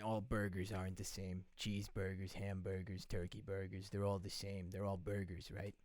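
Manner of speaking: low, quiet, even deadpan; deadpan arguing. Pretend. Patient pressing a silly point to his therapist, low deadpan. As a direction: make the silly case quietly, low and unbothered.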